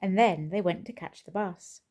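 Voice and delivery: plain reading voice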